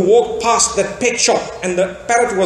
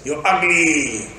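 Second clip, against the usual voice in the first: slow, lower mock parrot voice, a touch cheeky; rude parrot; 6 semitones lower, 3 dB quieter, slower than usual. Parrot insulting the passing woman, slow mock bird voice. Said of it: "the parrot says".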